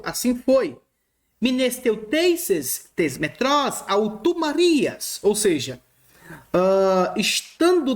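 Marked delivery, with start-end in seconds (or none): 1.4-5.2 slow Greek reading. Shown as a demonstration. Reads Greek text slowly.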